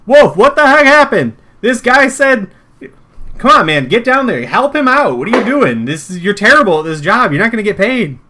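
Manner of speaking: high loud angry telling off; scolding boss. Acted. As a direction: chew Wolf out, loud and high. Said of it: "they're like".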